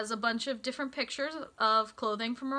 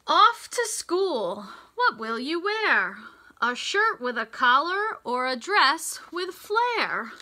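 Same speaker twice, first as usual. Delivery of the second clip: bright, sing-song storybook read; lilting picture-book voice; reading to children; 4 semitones higher, 4 dB louder than usual. Acted. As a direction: read the rhyme to a class of little kids. Reads rhyming picture book aloud.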